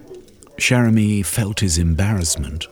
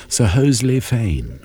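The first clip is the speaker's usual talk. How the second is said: ordinary delivery, nothing marked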